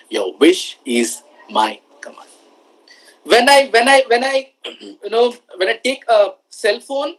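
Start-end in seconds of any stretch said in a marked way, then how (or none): 0.1-2.3 deep, slow genie voice; slow, low and grand. Retold or imitated. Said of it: "And genie says".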